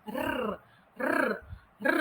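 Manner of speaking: R trilled with the tongue tip; a buzzing tongue-trilled R; rolled R. Shown as a demonstration. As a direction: roll the R, let the tongue vibrate. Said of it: "menggetarkan sahaja lidah awak".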